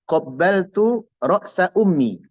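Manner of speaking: slow, careful Arabic example; Arabic example read slowly and deliberately; example sentence. Shown as a demonstration. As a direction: spell out each Arabic word slowly for students. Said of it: "Contohnya saya bilang".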